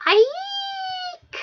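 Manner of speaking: very high, loud creature shriek. Acted. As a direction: squeal like a startled beast.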